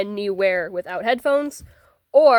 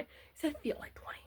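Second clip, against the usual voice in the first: whispering; 5 semitones higher, 17 dB quieter than usual. Acted. Whispered aside to camera.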